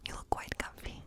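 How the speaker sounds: ordinary talk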